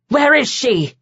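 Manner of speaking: plain reading voice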